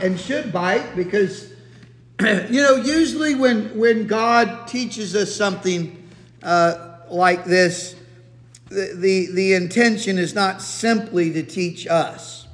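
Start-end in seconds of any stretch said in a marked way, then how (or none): none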